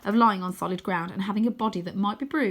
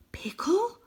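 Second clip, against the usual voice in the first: breathy high whisper of a name; 12 semitones higher than usual. A narrator's reading. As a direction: call his name in a hushed whisper. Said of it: "I whisper".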